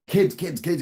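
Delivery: put-on high, urgent call to the kids. Neighbor dad calling his kids to see, high urgent call. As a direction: summon them outside fast. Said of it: "he's like".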